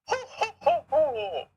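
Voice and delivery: very high, haughty voice with gloating ho-ho laugh; posh voice. Acted. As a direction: sneer down at the poor player. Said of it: "the posh voice?".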